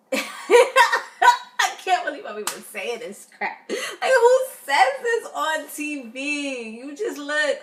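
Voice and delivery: laughing through the words, slowly; laughing disbelief. Real, not acted. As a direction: crack up at how absurd it is. Laughing at absurd TV moment.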